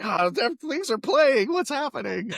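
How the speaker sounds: high-pitched comic alarm; mock fluster at devices going off; retold panic. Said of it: "you had the panic".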